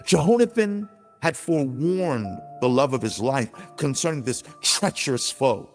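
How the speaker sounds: ordinary delivery, nothing marked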